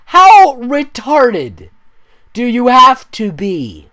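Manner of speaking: high, loud and dragged out; loud drawn-out disbelief; slow exasperated shout. Real, not acted. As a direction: stretch every word in outrage. Ranting at the fanfic.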